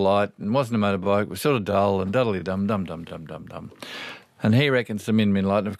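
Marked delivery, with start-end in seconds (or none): 2.1-3.7 rhythmic dum-dums fading away; imitated dum-dum sound. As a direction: let the repeated dums sink lower and softer. Mimics the dull light following the driver.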